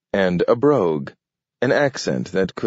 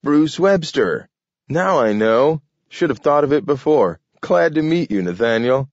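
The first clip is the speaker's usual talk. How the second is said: a touch of excitement; excited realization; 7 semitones higher, 3 dB louder than usual. A narrator's reading. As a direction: snaps to the answer, pleased. Grant realizes and greets.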